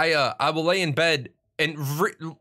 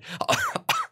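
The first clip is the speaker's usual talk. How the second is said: a sudden high squeal of laughter; real high-pitched giggle; high squeaky laugh. Real, not acted. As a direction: let a laugh break up into a squeak. Laughing at own story.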